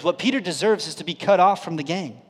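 ordinary delivery

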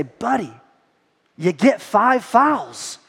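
very slow, deliberate, conspiratorial; slow sly advice. Retold or imitated. Dad telling his son through the mirror, slow and sly. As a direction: drag it out like a secret tip.

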